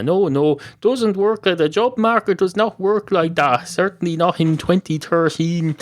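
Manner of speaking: high-pitched dismissive protest; indignant rebuff. Acted. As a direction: set the dog straight, pitched well up. Barman rebukes dog asking for job.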